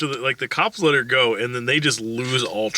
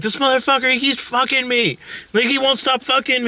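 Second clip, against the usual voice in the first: mock screaming; 10 semitones higher than usual. She rants outside at the arriving cops, mock screaming. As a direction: imitate a hysterical accusation way up high. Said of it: "like, screaming".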